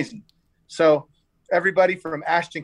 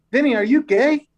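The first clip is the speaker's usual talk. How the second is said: pitched up and louder, half joking; shocked blurt; 8 semitones higher, 4 dB louder than usual. Retold or imitated. TJ blurting out a question to his friend, pitched-up mock shock.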